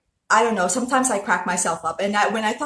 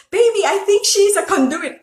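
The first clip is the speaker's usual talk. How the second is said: higher, louder teasing re-enactment; playful mispronouncing joke; 8 semitones higher, 5 dB louder than usual. Retold or imitated. Host replays her joke with boyfriend, playful high-pitched teasing. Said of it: "I started saying the word".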